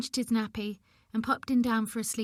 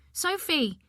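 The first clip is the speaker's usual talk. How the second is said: higher, sharp shout of her name; 7 semitones higher than usual. Acted. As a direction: yell her awake.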